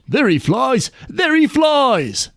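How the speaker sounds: high drawn-out call of the king's men; bawling out. A narrator's reading. King's men shouting after the flying hawk, high calls. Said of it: "bawl out".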